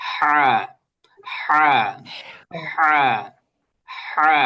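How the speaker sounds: হা from the throat, repeated drill; throat haa. Shown as a demonstration. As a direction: breathe হা out of the throat each time. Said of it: "গলার, আহ, মাঝখান থেকে". (গলার মাঝখান থেকে হা, বারবার)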